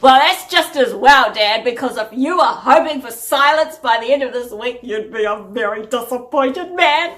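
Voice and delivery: loud mock warning to Dad; playful retort. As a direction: tease Dad with a big, emphatic comeback.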